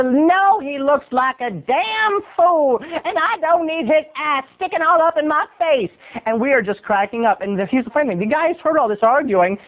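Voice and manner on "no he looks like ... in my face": high, slow, drawn-out disapproval; put-on voice of a cool woman passing judgment; cool, disapproving lady. Lady in business suit judges the stretcher, disapproving.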